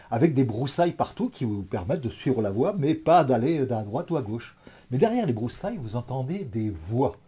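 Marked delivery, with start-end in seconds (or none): none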